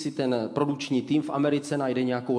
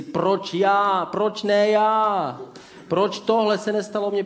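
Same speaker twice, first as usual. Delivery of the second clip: higher, louder whining protest; mock self-pity; 7 semitones higher, 6 dB louder, slower than usual. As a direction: whine like a hard-done-by child. Mock self-pitying envious complaint. (ukřivděné fňukání, výš a hlasitěji)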